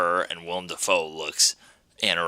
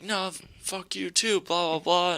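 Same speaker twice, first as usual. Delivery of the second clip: mock yell; 12 semitones higher, 4 dB quieter than usual. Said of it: "he's just yelling".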